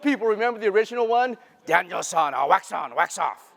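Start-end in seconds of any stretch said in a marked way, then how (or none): 1.6-3.5 hammed-up Japanese-accented sensei, a bit lower; bad Mister Miyagi accent. Speaker reminds audience of Karate Kid's teacher, in a mock Japanese accent. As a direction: imitate an old film mentor's Japanese accent. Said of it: "my very bad Mister Miyagi accent that I learned from watching Karate".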